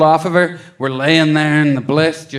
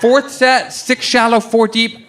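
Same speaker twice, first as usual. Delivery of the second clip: excited counting; 8 semitones higher, slower than usual. Retold or imitated. Excited counting, much higher.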